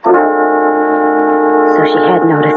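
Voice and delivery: slow, whispered thought; inner thought whisper. Acted. Sybil thinking to herself at dinner, slow whisper. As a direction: breathe it as a secret thought. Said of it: "inner thought".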